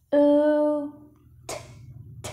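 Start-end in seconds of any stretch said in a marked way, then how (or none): none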